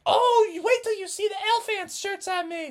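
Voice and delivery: high, squeaky cheerful mom voice; giddy team mom. Acted. Missus Frogerson cheering the boys on, high and giddy.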